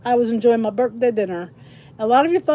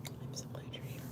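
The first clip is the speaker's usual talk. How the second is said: whispered welcome; 24 dB quieter than usual. Real, not acted.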